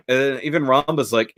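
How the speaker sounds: ordinary talk, nothing marked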